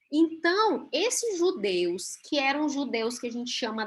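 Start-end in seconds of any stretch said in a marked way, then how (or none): none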